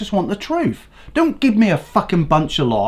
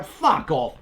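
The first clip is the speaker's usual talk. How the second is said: ordinary delivery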